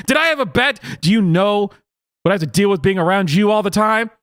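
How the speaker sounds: hammed-up fed-up girlfriend; exasperated. Girlfriend snapping back at boyfriend in mock exasperation. Acted quarrel, exasperated reply.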